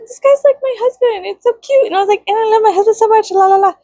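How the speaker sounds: high, sing-song gushing voice; gushing girly voice. Retold or imitated. To herself about the game character, high gushing voice. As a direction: play the smitten fan, bubbly and high.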